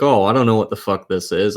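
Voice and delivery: usual speaking voice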